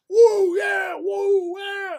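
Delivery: imitating loud hyped Americans; hyped American whooping. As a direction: whoop like a thrilled skydiver. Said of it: "high-fiving the crap out of each other and going".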